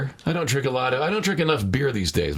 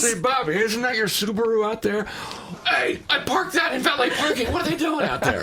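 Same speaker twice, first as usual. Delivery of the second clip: laughing mock bystander; 7 semitones higher than usual. Acted parkers spotting their cars.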